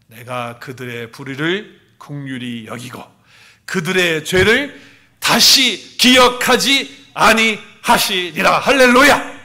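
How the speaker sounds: slow, building to a loud recitation; recitation aloud. Real, not acted. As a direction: read it out together, rising to the hallelujah. Congregation recites Hebrews 8:12.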